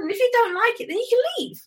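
lightly voiced persuasive friend; friend coaxing. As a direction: coax her like an upbeat friend. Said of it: "And she goes".

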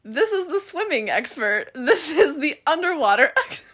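exaggerated announcing that breaks into a laugh; mimicked introductions. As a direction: present each one emphatically, cracking up by the end. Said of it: "that they introduce these people are like".

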